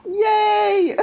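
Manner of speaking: high-pitched, drawn-out whoop; excited cheer. Real, not acted. Host celebrating the title of her notes, excited.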